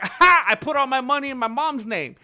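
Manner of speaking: high, loud, taunting retort; mock defiance. Streamer acts out fighter daring promoters to sue, mock defiant. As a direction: crow it, daring them to try. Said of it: "He's gonna be like".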